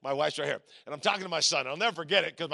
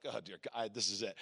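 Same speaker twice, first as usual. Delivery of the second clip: very low, soft dread; hushed mock last prayer; retold nervous prayer; 10 semitones lower, 12 dB quieter than usual. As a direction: murmur it to yourself, acting doomed. Nervous inner prayer before the jump.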